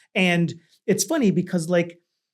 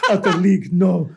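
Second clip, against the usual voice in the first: put-on Russian accent; 5 dB louder than usual. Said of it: "But with your Russian accent".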